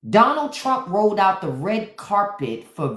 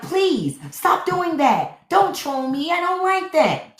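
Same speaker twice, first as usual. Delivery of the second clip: high, slow whiny begging; hammed-up pleading voice; mock pleading victim; 9 semitones higher, slower than usual. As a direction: beg like a wounded snowflake. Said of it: "the person is like".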